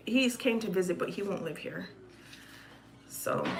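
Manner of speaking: ordinary talk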